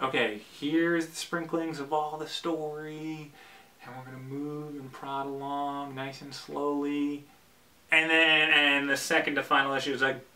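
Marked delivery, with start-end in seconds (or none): 0.0-7.2 slow, soft, drawn-out demo; slow and delayed. Shown as a demonstration. Vlogger mimics a writer's delayed storytelling, slow and soft. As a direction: let it plod along gently, stretching the words. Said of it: "this delayed thing going on".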